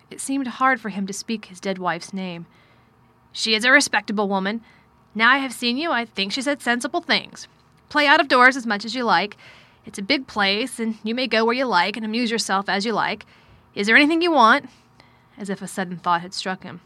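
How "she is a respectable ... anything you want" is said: loud, emphatic, lightly voiced man; distracted but well-meaning guardian, forceful; troubled guardian. A narrator's reading. Guardian telling his ward his intentions, loud and troubled. Narrator voices Mister Craven.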